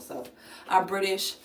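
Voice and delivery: usual speaking voice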